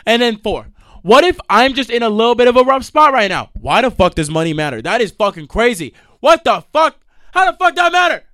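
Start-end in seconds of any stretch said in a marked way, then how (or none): 1.0-3.5 loud, high, indignant protest; indignant rant. Real, not acted. Ranting about being judged for money. 6.2-8.2 loud, high-pitched exasperated yell; outraged shout. Real, not acted. Ranting that money should not matter.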